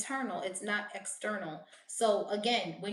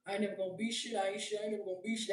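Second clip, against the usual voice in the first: mock self-pity; 4 dB quieter, much faster than usual. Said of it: "So they be like".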